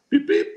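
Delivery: two very high, loud beeps; imitated car beep. Man imitating the car answering a key fob, high beeps. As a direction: mimic a car's electronic chirp.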